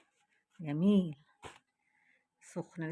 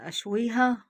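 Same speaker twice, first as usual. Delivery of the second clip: higher, much louder burst on اشويها; exclaimed; 6 semitones higher, 10 dB louder, slower than usual. Real, not acted. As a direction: blurt out اشويها high and loud. Hesitating over grilling herring. (اشويها بصوت عالي)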